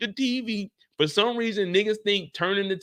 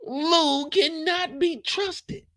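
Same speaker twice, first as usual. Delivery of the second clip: slow, drawn-out lament; 7 semitones higher, much slower than usual. Acted. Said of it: "mock dramatic slow repeat".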